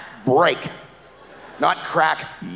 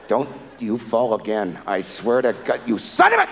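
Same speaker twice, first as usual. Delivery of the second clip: building angry curse; 4 semitones lower than usual. Retold or imitated. Cursing the boat in the bottle.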